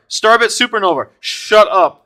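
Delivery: high, loud, thrilled outburst; stunned excitement. Real, not acted. Streamer naming the card just pulled, thrilled shout. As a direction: cry out the find in disbelief. Shock at pulling rare card.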